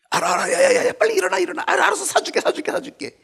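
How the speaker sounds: high, loud panicky parent; mother caving to a tantrum; flustered mother. Pretend. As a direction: hurry the kid up, embarrassed.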